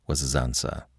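usual speaking voice

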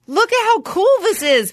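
high-pitched gushing; excited amazement. Retold or imitated. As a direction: gush like a smitten new fan. Retells own excitement at the show.

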